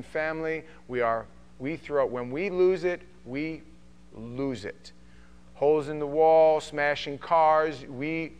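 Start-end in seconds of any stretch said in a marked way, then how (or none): 3.3-4.9 quiet, heavy emphasis; hushed. Acted. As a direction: let it fall almost to a murmur. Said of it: "drops voice on repeated phrase".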